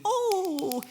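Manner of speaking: hammed-up gushing Jesus, mocking a magic-trick faith; mock pleased Jesus. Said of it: "Jesus is gonna be like".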